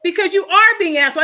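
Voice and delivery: loud, high, forceful retort; heated accusation. Real, not acted. Panelist tells host why she called him that, heated accusation.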